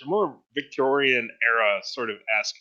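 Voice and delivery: normal talking voice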